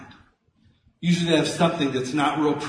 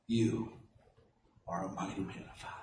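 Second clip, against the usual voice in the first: gentle voice of God; 8 semitones lower, 14 dB quieter than usual. Pretend. God's voice affirming, very soft.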